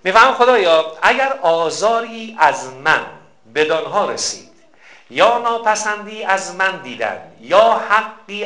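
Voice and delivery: slow, measured supplication; slow prayer reading. Acted. Preacher reading the closing prayer for parents, slowly. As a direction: draw out each phrase of the prayer.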